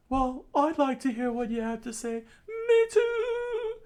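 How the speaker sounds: very high, put-on squeaky voice; eager falsetto chiming in; high-voiced story character. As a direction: pitch it way up like a cartoon character. Story character speaking in dialogue.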